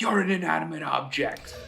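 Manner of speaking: half-hearted retold shout at a wife; retold yell. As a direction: imitate an angry husband's outburst, held back. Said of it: "yells at his wife".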